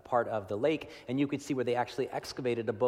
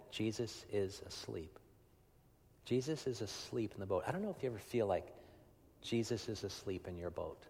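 hushed emphasis; 8 dB quieter than usual. Real, not acted.

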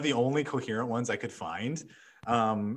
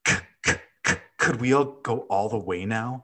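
pleading stammer; faster than usual. A narrator's reading. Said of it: "she asked, looking at him with pleading eyes".

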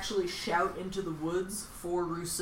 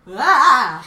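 loud, high imitated scream; comic yell of alarm; hammed-up "aah" of panic; mock panicked yell; 6 semitones higher, 18 dB louder than usual. Imitates Hurley's panic at arrest.

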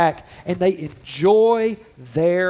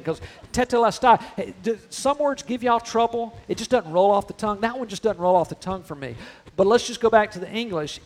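ordinary talk

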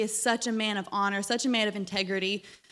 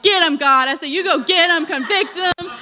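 louder fired-up cry for payback; loud cheer; 5 semitones higher, 8 dB louder than usual. Retold or imitated. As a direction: cheer it on like a fan. Retelling cheering God on.

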